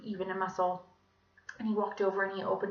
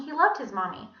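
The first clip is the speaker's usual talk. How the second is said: ordinary delivery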